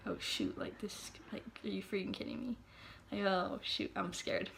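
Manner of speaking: hushed panicked whisper; scared whisper. Retold or imitated. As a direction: whisper it like you're afraid it hears you. Scared at the Ouija board.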